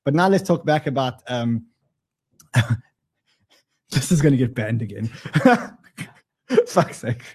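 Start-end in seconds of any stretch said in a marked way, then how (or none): 2.5-7.4 laughing through the words; helpless chuckles between phrases. Real, not acted. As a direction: break up laughing at your own remark. Amused at his own risqué tangent.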